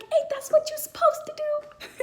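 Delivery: high, loud indignant protest; squeaky loud retort; indignant exclaim. Retold or imitated. Retorting to nurses mid-labor.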